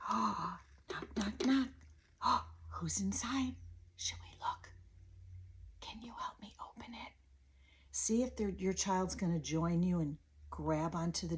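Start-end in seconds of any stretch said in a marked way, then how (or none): 0.1-1.7 put-on hushed, curious knock-knock for a child; soft, secretive play voice; playful whisper. Shown as a demonstration. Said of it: "sometimes when you whisper, it's good". 2.2-7.1 hushed wonder, as if sharing a secret; very soft, breath-only questions to a toddler; playful whisper. Shown as a demonstration. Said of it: "sometimes when you whisper".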